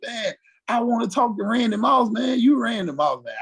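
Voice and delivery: eager fan gushing; starstruck. Retold or imitated. Rookie gushing at Randy Moss, eager and starstruck. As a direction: gush like a kid meeting his idol. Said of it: "I'm like".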